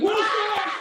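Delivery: high shocked shout; mock outrage. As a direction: yell it in fake disbelief. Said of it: "I was like".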